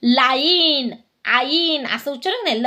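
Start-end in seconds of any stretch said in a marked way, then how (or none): 0.0-0.9 "लाईन" with a drawn-out "आई" vowel; "आई" sound demo. Shown as a demonstration. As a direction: say "लाईन" as laa-een, vowel fully sounded. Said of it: "त्याचा उच्चार आई असा होतो". ("लाईन" मधला "आई" स्पष्ट उच्चारून)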